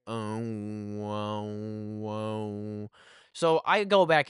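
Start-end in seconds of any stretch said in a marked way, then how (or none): 0.1-2.9 low, long-held mock stoned "whoa"; dazed "oh, whoa". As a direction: imitate a tripping friend droning in amazement, softly. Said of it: "Just going".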